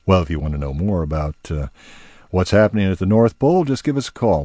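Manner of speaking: fast promotional outro; promo read. Acted. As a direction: pitch the listeners a call.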